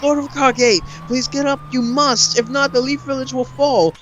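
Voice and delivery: high, urgent mock plea; pleading men. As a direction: beg your fallen leader to rise. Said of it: "all the third Hokage's men are like".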